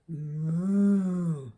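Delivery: loud, drawn-out moo; cow mooing. A narrator's reading. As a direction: moo like a cow in a picture book. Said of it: "said Cow".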